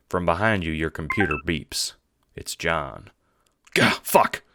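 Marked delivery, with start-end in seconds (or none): 3.7-4.4 higher, louder startled outburst; startled cry. Acted. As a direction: yelp it out in sudden alarm. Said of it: "the other guy cries".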